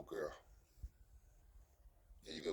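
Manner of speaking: usual speaking voice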